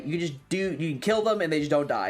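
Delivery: usual speaking voice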